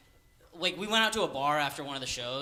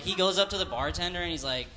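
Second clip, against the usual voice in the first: ordinary delivery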